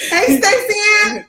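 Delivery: high, bright greeting called out; shout-out to Stacey Ann; greeting a viewer warmly; calling out. Real, not acted.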